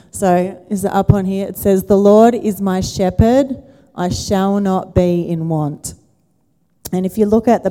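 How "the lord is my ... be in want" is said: scripture reading. Acted.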